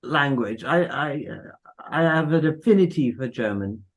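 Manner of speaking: usual speaking voice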